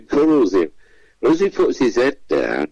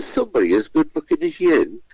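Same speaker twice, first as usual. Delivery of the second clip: higher, camped-up teasing voice; camp host; 7 semitones higher than usual. Retold or imitated. Host teasing the handsome contestant, higher camp voice. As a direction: tease him like a camp game-show host. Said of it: "I'm campered up a bit".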